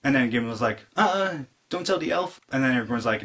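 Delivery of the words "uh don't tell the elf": higher-pitched, hesitant mock plea; hammed-up reluctant request; imitating Gimli. Dwarf asking his friend to keep a secret, mock sheepish.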